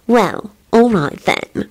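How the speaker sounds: slow, high, saucy agreement; teasing drawn-out consent. A narrator's reading. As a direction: give in coyly, drawing it out. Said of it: "gave him a saucy smile".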